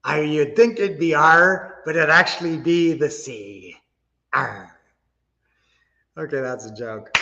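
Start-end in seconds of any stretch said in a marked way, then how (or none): 0.0-4.8 slow pirate growl. Pretend. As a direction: ham it up like a pirate. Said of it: "I get to do a pirate voice".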